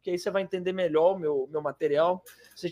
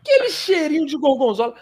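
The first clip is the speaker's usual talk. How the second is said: laughing through the words; spoken through a laugh; 7 semitones higher, 5 dB louder than usual. Real, not acted. Laughing at the smell joke.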